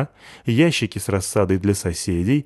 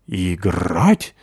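mocking the dog; 3 semitones lower than usual. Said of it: "передразнил Рокки кот".

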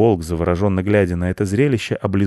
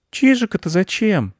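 put-on high-pitched child's question; little boy who can't understand; baffled boy; 12 semitones higher than usual. A narrator's reading. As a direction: ask it way up high, puzzled.

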